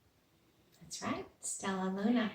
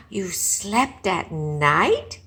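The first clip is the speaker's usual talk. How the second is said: gasped; 9 dB louder, much slower than usual. Acted. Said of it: "gasped another".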